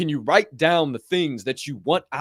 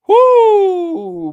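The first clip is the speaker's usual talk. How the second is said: a big, high, loud whoo; excited whoop; 12 dB louder than usual. Real, not acted. Host revving up viewers for the final clip, loud excited whoop. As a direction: let out a hyped whoop. Hyped reaction after clip intro.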